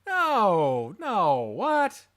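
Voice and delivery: high, loud wail of denial; loud, swooping disbelieving protest; shocked disbelief. Real, not acted. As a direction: cry out in stunned denial. Refusing to believe the twist.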